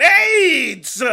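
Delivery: high, full-throated scream of one word; screamed punchline. Acted. Comic screaming the answer to a stranger's question. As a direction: blast it out.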